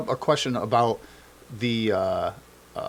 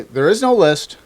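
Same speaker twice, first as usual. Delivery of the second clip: mock denial; 5 semitones higher, 6 dB louder than usual. Mocking the denial again.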